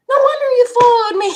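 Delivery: high, loud, fast laughing exclamation; rushed, squealed punchline through laughter; laughing realization. Real, not acted. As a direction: burst it out while cracking up. Laughing at the dog's name.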